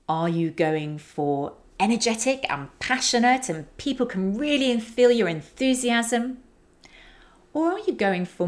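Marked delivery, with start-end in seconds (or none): 1.8-6.4 higher, enthusiastic lift; energetic and passionate. Shown as a demonstration. Coach showing speakers high energy, energetic and enthusiastic. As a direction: build the enthusiasm, pitch climbing to the end. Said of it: "the examples I just did".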